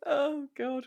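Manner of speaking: normal talking voice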